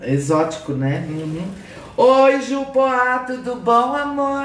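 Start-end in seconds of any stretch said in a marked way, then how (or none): 2.0-4.4 high, slow drawn-out hello; slow high viewer greeting; sing-song greeting. Real, not acted.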